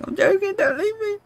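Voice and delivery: high, soft fake crying plea; mock sob. Begging chat not to leave, in mock high tearful whine. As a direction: whimper it like a hurt child, hammed-up.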